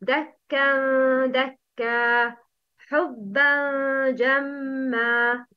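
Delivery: slow, nasal ikhfa; إخفاء demonstrated slowly; concealed nun. Shown as a demonstration. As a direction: hide the nun with a nasal hum. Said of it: "إخفاء حقيقي".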